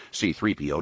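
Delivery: plain reading voice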